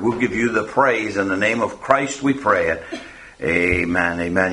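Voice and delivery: low, hushed prayer voice. Acted. Pastor prays after reading.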